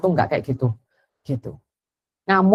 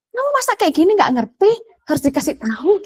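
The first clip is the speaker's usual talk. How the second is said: mock scolding parent; 10 semitones higher than usual. Parent scolding child retold.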